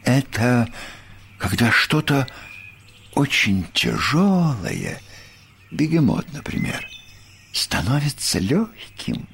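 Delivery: thoughtful, airy reverie that drifts away; pensive musing. A narrator's reading. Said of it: "задумчиво произнёс Бараш".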